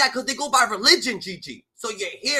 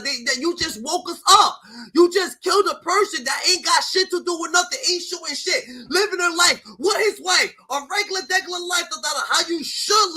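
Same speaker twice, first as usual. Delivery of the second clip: higher, louder furious shouting; angry rant; 5 semitones higher, 3 dB louder than usual. Real, not acted. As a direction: yell it out in real outrage. Angry rant at the cheering crowd.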